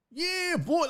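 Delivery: imitated high-pitched hype-man holler. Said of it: "like, a, a hype man".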